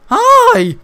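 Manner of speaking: high squeaky echo of the greeting; mock greeting. Gamer mimicking the NPC's greeting, high and loud. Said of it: "NPC greets him".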